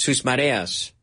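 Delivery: ordinary talk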